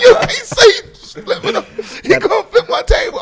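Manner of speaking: high, loud fan excitement, laughing; pumped up. Retold or imitated. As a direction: reenact being thrilled at a shout-out. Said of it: "I was pumped".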